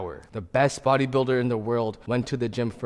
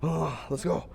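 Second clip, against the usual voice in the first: strained; 5 semitones higher than usual. Real, not acted. Psyching up before the set.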